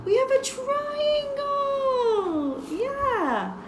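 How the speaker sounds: high, loud delighted reveal; excited. Acted. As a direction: cheer the triangle reveal. Teacher reveals triangle to kids.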